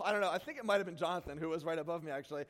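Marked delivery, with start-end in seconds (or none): none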